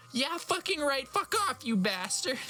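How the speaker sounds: very high hammed-up fury; over-the-top teen tantrum; mock scream. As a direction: shriek the insults way up. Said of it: "I screamed".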